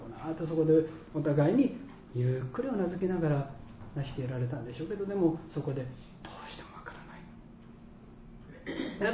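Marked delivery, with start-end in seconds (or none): 6.2-9.1 whispered, much softer than usual; under the breath, almost no voice; hushed retold doubt. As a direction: let it out as a barely voiced admission. Retelling a doubter's quiet confession.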